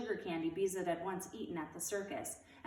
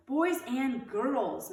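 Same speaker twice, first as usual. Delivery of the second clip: slow, loud, higher call to the class; raised voice; 6 semitones higher, 6 dB louder, much slower than usual. A narrator's reading. Teacher Miss Robbins calling the class, raised voice. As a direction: call the room to order. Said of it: "Miss Robbins raised her voice".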